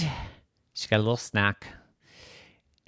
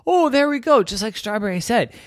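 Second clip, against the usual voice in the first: high-pitched, excited "aha"; pleased recognition; 11 semitones higher, 4 dB louder, faster than usual. Acted. Pudding spots the landmark Strawberry described, excited. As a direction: burst out high with relief at finding the way.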